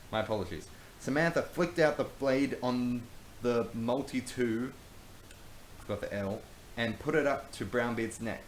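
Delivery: normal talking voice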